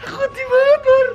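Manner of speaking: very high, tearful squeal; panicked crying. Real, not acted. Pleading to War from the dark, panicked wailing. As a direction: wail in real panic, pitch shooting up. Panicking alone, begging friend.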